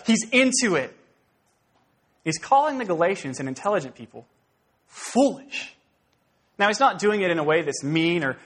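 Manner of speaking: ordinary talk, nothing marked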